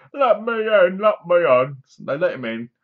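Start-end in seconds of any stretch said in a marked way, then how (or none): 0.1-1.8 louder, higher pleading cry to be let in; hammed-up banging at the door, begging; mock pleading shout. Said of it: "he bangs on the door".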